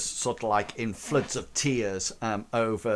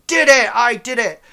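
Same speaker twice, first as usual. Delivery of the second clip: high, loud victory yell; triumphant cheer; 11 semitones higher, 11 dB louder than usual. Acted. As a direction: shout it out like you just won. Crank cheers after beating boss.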